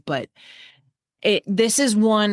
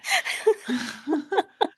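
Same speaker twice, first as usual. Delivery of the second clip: high bright laugh; 10 semitones higher than usual. Real, not acted. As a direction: burst out laughing. Laughing at teasing.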